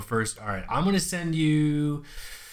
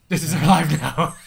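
louder, higher echo; emphatic echo; 3 semitones higher, 9 dB louder than usual. Real, not acted. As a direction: shout it back in agreement. Echoed louder, laughing.

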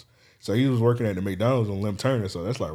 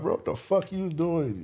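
disbelief; 7 semitones higher, 3 dB quieter than usual. Retold or imitated. Incredulous reaction to friend's firing.

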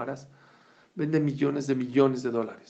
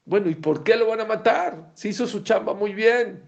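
raised voice; 8 semitones higher, 8 dB louder than usual. Real, not acted. Preacher raising the question.

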